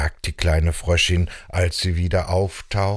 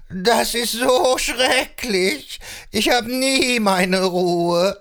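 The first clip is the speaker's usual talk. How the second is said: higher, wavering goat complaint; put-upon billy goat; 10 semitones higher than usual. Acted. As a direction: lament like a harried billy goat. Ziegenbock klagt. (klagender Ziegenbock mit Meckerstimme)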